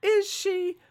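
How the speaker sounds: mock-incredulous, rising high; sceptical. Real, not acted. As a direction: ask it as if the answer is clearly no. Doubting the show's charm claim.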